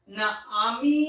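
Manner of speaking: normal talking voice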